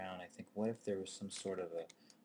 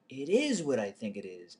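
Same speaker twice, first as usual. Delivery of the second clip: horrified realization; 4 semitones higher, 10 dB louder than usual. Retold or imitated. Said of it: "closer examination".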